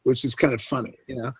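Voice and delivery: plain reading voice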